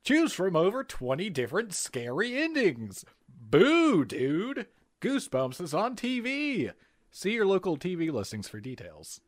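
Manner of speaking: booming TV promo announcer. Pretend. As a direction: sell it like a fighting game announcer. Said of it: "you have the best, like, announcer voice".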